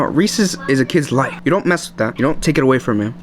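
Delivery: ordinary talk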